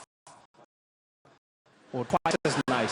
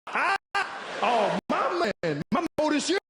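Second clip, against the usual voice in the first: high, loud, hammed-up cackling laugh; mock hearty laugh between friends; 10 semitones higher, 4 dB louder, slower than usual. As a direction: laugh it up big like the coolest guy around. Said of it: "I was like".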